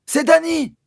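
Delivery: high loud call; boy announcing himself to his father, shouting. Acted. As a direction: yell your name into the dark. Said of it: "j'ai crié".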